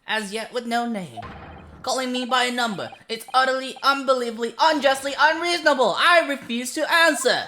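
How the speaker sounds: indignant tirade. Acted. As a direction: rant at being called a number.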